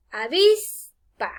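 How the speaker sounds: slow "Avispa", VIS drawn out, PA after a gap; stress on middle syllable. Shown as a demonstration. Teacher proving the penultimate stress, stress on middle syllable. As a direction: stretch VIS in "Avispa", then release PA. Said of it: "En la penúltima sílaba".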